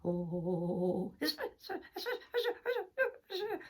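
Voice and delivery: stuttering, clucking hen holding it in; flustered hen bursting to speak; beside herself. Acted. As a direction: start rising into a cackle and stop. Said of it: "she was beside herself".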